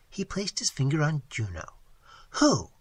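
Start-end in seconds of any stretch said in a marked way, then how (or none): 2.4-2.7 WHO punched louder and higher; emphatic question. A narrator's reading. Seminole man asks about a picture, emphatic. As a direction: put firm force on WHO. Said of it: "he inquired emphatically".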